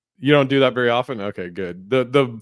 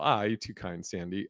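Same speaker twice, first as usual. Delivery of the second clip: quiet, fading mock-humble thanks; bow out modestly to a fan; softly touched by the compliment; 4 semitones lower, 9 dB quieter than usual. Replying to listener's compliment.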